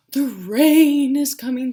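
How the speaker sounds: zebra calls out, loud and high. A narrator's reading. Zebra loudly announces rain to friends. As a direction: shout the news to everyone.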